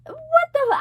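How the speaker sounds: high mock-shocked outburst; freaked out. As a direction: act out freaking out, cut short. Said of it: "I literally freaked out".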